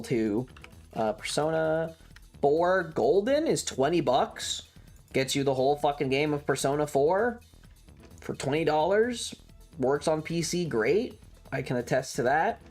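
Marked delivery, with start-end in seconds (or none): none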